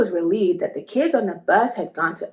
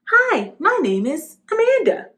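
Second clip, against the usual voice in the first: bright, higher and louder hello; cheerful greeting; 9 semitones higher, 6 dB louder than usual. A narrator's reading. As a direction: try the name out brightly in the mirror. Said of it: "she said cheerfully".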